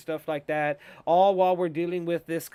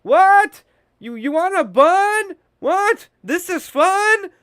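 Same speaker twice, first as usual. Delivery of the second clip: high, loud, comic mishearing voices; 11 dB louder, slower than usual. Retold or imitated. The kids mishearing Joel's warning, high and loud. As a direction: ham up not hearing a word. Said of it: "They're like".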